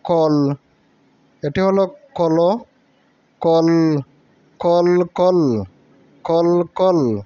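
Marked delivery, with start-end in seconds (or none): 4.6-5.6 কল with the ল drawn out very slowly; held l. Shown as a demonstration. Teacher stretching the ল for the class. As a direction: hold the ল in কল long. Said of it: "উচ্চারণগুলি ভালো করে লক্ষ্য করবে".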